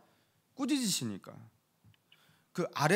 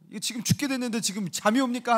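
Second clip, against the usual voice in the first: alarmed reproach; 6 semitones higher, 3 dB louder than usual. Acted. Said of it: "하면서 막 이렇게 깨운다든가".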